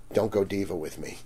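low, soft retold warning; quiet warning. Booth owner to a diva customer, quietly warning. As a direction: warn him off under your voice.

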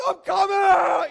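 slow, stretched shout, high and loud; desperate shout while fleeing the cave; panicked yell. Retold or imitated. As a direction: bellow it as you scramble out.

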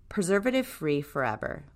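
usual speaking voice

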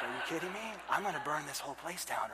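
very quiet, low, comic fuming; a low, understated threat under the breath; mock seething. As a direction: say it softly in pretend disbelief. Retold fuming at unlit wood.

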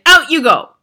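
high, loud dismissal; shouted order. A narrator's reading. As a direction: shout them out of the room. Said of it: "she shouted".

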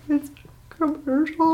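high, drawn-out mock-tearful wail; blubbery high-pitched whimper, hammed up; imitating sobbing. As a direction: squeak it out through pretend sobs. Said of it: "sobbing, tears running down my face".

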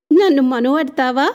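teasing, bright and loud question; giggling. Acted. Rangi giggling as she teases him about marriage. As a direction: giggle it out like a playful girl. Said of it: "కిలకిలా నవ్వుతూ".

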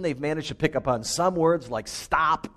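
usual speaking voice

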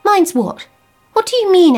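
high, loud, fearful question; wife suddenly frightened; alarmed. Acted. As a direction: demand it in alarm.

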